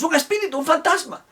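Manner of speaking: high panicked shriek of fright; yelling like madmen. Retold or imitated. Disciples seeing Jesus, high terrified yell. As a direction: squeal it out like you've seen a ghost. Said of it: "empezaron a gritar como locos".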